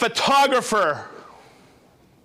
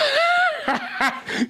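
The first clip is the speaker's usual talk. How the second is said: high burst of laughter; big laugh. Real, not acted. Host laughing after the crew corrects a name, high burst of laughter.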